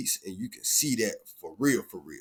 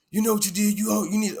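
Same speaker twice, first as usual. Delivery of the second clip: mock aggressive; 10 semitones higher, 4 dB louder, faster than usual. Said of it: "she's talking to me more aggressively".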